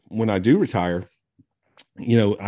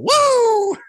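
high, loud wrestler's woo; Ric Flair woo; 6 dB louder than usual. Retold or imitated. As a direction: let out the Nature Boy's trademark yell. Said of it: "to Ric Flair walking down the aisle".

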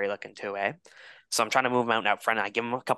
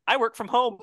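smug comeback; 12 semitones higher, 3 dB louder than usual. Retold or imitated. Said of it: "I thought that was a great response".

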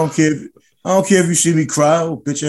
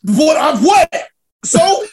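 very high, incredulous squawk; high-pitched comic defiance; defiant outburst; 12 semitones higher, 4 dB louder, much slower than usual. Real, not acted. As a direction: throw the questions back high and indignant. Defiant reply to teasing about crying.

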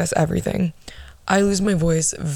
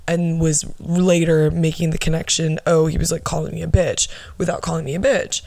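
ordinary delivery

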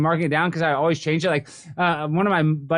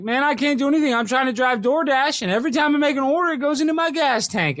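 high goofy voice of a struggling delivery driver; goofy imitation; 7 semitones higher than usual. Retelling a viral clip, guy at the gas pump in a goofy high voice. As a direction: mimic a goofy complaining guy, pitched up. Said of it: "the way he sounded was, like, is goofy".

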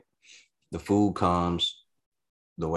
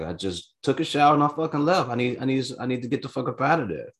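heated self-defence; 6 semitones higher than usual. Retold or imitated. Defending himself to cousin.

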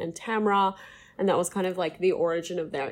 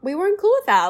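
higher, louder indignant protest; emphatic; 11 semitones higher, 7 dB louder, faster than usual. Real, not acted. Hosts protesting the confessional, higher louder indignation. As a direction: push back hard on the scene. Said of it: "emphatic disapproval".

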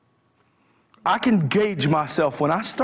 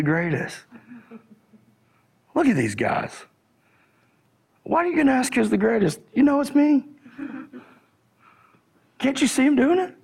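playing Peter; 7 semitones higher than usual. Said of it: "Peter's the one going".

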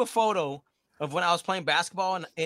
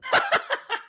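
high laugh. Real, not acted. Laughs at own joke.

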